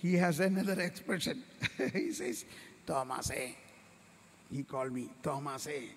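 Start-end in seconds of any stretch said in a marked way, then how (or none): 2.8-3.5 lower, softer loving call of "Thomas, eh?". Acted. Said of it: "Jesus said very lovingly". 5.2-5.9 lower, softer loving call of "Thomas, eh?". Acted. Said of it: "Jesus said very lovingly".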